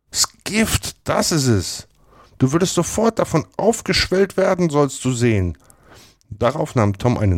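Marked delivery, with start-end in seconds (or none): 0.1-5.6 put-on high-pitched, emphatic boy's warning. A narrator's reading. Tom explains the poison to Huck, warning. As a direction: warn a friend in a high boyish voice.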